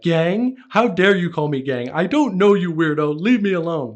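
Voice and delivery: loud, high mock-outraged shout; indignant outburst. Rapper imagined rebuking a fan, indignant shouting. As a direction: yell it as if deeply affronted. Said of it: "I can just imagine him standing there like".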